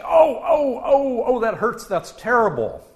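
high, loud hammed-up cries of pain; pained outcry. Patient whose thumb is whacked with a hammer, loud hammed-up pain cries. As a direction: yelp like your thumb just got hammered. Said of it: "in a very flat voice".

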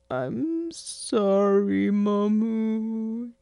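high, slow weepy apology; a child sobbing out sorry; tearful. Acted. Son apologizing to his mother through tears. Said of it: "he said through his tears".

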